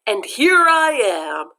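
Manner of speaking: bright arriving teacher, slow; cheerful teacher voice. Acted. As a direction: announce yourself warmly and slowly.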